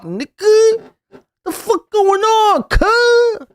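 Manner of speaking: mocking her as a wannabe gangster, hammed up.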